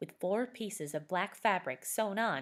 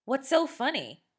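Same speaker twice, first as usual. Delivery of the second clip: demanding; 8 semitones higher, 6 dB louder than usual. A narrator's reading. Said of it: "Scott demanded".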